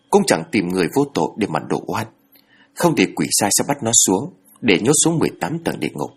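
plain reading voice

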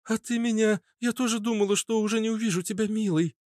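very high, thin female voice; high falsetto woman's voice; Natasha. A narrator's reading. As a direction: speak as a tearful-relieved sweetheart, high. Natasha answers her lover.

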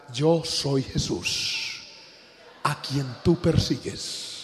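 quiet, unhurried divine reply; solemn voice of Jesus. Retold or imitated. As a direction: let the name fall slowly and heavily. Voice of Jesus answering again.

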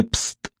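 soft, hissed "psst"; a hushed psst to catch someone's attention; beckoning psst. A narrator's reading. Ghost calls the narrator from behind a column, beckoning psst. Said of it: "услышал чьё-то призывное".

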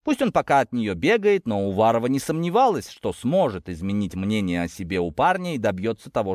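plain reading voice

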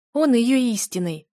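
high, loud and slowed; emphatic revelation. A narrator's reading. Wife revealing the dragon is their daughter's mate, loud. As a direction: drive the truth home. Revelation shouted mid-quarrel.

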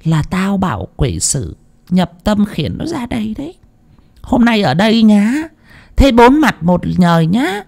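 high, shrill possessed woman's shriek; ghost hissing. A narrator's reading. Spirit inside the woman threatening the family, high hissing. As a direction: hiss threats like a spirit in a body. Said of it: "Vong nhập ... rít lên".

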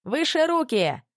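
high-pitched commanding call; полицейский окрик. A narrator's reading. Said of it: "кричала полиция". (высокий приказной выкрик)

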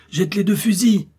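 high, loud command; urgent cry. Acted. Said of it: "s'écria le docteur". (ordre crié, aigu et fort)

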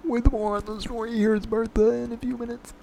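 high announcer voice. Pretend. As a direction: sell it like a cheesy promo. Mock ad and news announcer.

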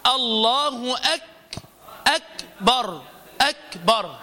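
أكبر with a crisp still kaf, slowed; teaching demo of a clean kaf; clear kaf demo. Shown as a demonstration. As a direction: model the silent kaf in أكبر slowly. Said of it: "فأنطقها هكذا".

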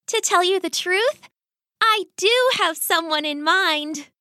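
a hint of a coy young rat girl; lightly voiced daughter, confiding; shy daughter.

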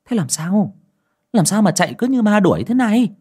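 an aunt fretting over a fleeing woman; worried. Acted. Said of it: "lo lắng".

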